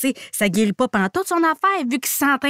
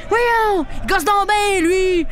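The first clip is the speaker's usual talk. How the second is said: mock coach rant; 8 semitones higher, slower than usual. Coach mocking the rival in a raised, higher voice. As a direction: coach griping about a pesky rival, mocking.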